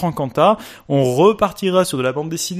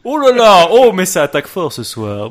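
mock offended; 4 semitones higher, 8 dB louder than usual.

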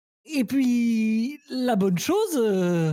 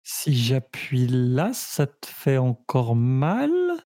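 low gentle probing; 9 semitones lower, slower than usual. Acted.

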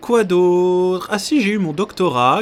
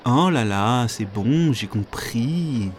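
weary giving-up material; 4 semitones lower, much slower than usual. Pretend. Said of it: "le matériau fait".

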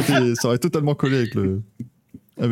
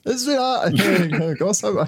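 high excited greeting; 6 semitones higher than usual. Pretend. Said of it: "t'as [name] qui".

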